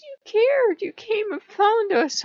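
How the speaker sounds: a god's high, pleased reply; god speaking. Retold or imitated. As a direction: squeak it out as the amused god. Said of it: "He goes".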